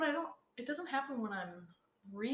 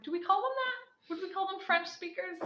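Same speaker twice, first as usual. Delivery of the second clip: surprised; 10 semitones higher, 4 dB louder than usual. Retold or imitated. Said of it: "And I'm like".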